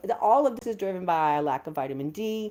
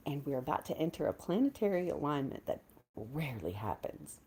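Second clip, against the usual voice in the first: softer whispered wonder; awed whisper; 4 semitones lower, 8 dB quieter than usual. Real, not acted. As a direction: whisper it like a secret of the sky. Whispered cosmic awe.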